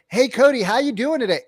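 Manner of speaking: high-pitched mock sales greeting; random salesperson. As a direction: imitate an over-eager cold caller. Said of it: "random salesperson".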